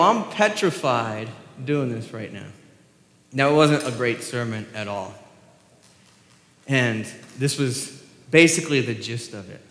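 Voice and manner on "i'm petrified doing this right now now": normal talking voice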